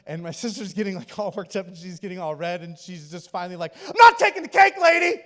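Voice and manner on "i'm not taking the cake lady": high, very loud exasperated outburst; snapping yell. Retold or imitated. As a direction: finally snap and shout it at the lady. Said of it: "she just, like, snaps".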